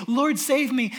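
desperate plea from a sinking believer; crying out to God. Acted. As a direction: cry out for rescue. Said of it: "you call out".

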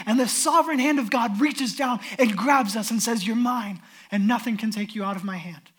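rising preaching. Acted. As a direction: preach it with growing fervor. Preacher builds to full force.

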